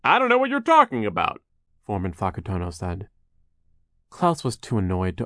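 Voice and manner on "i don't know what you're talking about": high, loud, exaggerated denial; feigned innocence. Foreman denies tripping Klaus, feigned innocence. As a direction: play dumb in a raised voice.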